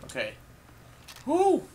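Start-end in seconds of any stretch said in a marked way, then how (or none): 1.3-1.6 high, loud cheer of relief; relieved whoop. Real, not acted. Survived a close call.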